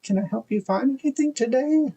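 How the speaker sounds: put-on high, frail old lady voice; high creaky shop-lady voice; old lady shopkeeper. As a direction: sweetly offer help like a tiny granny. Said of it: "She was like".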